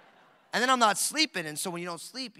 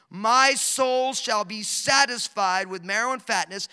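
slow, loud scripture proclamation; fervent reading; 3 semitones higher, 9 dB louder, slower than usual. Real, not acted. Preacher to congregation, reading the psalm, slow and loud. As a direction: dwell on each word of the psalm.

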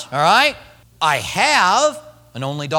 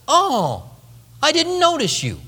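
mock surprise; 8 semitones higher than usual. God voiced as surprised, mock.

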